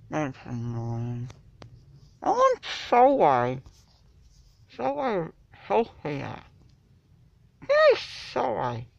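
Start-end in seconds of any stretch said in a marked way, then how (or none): 2.2-8.9 excited. Real, not acted. Excited craving outburst.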